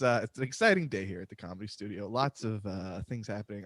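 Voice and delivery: ordinary talk, nothing marked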